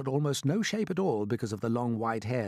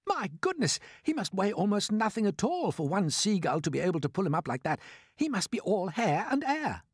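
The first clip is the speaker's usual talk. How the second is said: high-pitched delighted amazement; 7 semitones higher than usual. A narrator's reading. James watches the Cloud-Man fly off, marvelling with delight. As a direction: marvel happily, voice lifted high. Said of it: "watching him with delight".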